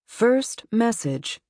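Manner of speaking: slow, high, stilted answering-machine voice. A narrator's reading. Answering machine announces the message, slow and stilted. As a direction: read it like a robotic recording. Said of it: "the machine's stilted voice said".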